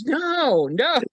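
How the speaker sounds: high, hasty double refusal; refusing chief of staff. Retold or imitated. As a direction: wave the chips away. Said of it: "He goes".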